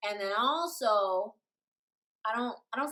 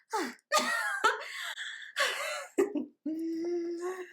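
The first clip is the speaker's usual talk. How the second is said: high laugh; 7 semitones higher than usual. Real, not acted. Laughs at own story.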